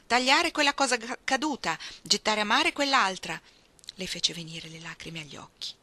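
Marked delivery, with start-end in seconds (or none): 0.1-3.4 loud, higher urgent orders; shouted commands. A narrator's reading. Young captain to his crew as masts split, shouted commands. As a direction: shout orders over a storm. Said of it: "lui urlava rapidi comandi".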